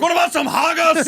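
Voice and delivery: mock Scottish. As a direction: ham up a Scottish voice.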